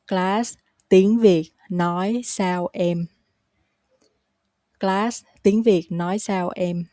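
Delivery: slow, word by word; slow repeat-after-me pace. Shown as a demonstration. Teacher has learners repeat the question, slowly. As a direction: leave small gaps between the words. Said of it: "You can repeat after me".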